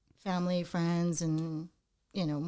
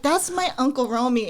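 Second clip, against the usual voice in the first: ordinary talk, nothing marked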